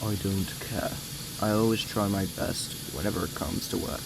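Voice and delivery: low, soft character accent; subdued, deep put-on accent; Tim accent. Shown as a demonstration. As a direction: drop the pitch and volume for an engine character's accent. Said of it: "So here's my accent of him".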